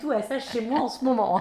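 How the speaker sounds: normal talking voice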